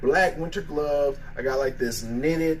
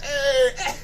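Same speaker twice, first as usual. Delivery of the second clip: much higher, crying laughter; laugh-crying, straining and hurting; laughing in pain; 13 semitones higher, 4 dB louder, much slower than usual. Real, not acted. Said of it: "this hurts so bad".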